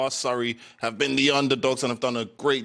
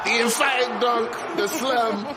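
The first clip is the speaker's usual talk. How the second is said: high, loud burst of real excitement; excited; 11 semitones higher, 4 dB louder, slower than usual. Real, not acted. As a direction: call the dunk like a thrilled commentator. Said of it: "Can you hear the excitement in my voice?".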